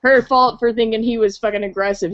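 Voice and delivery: ordinary talk, nothing marked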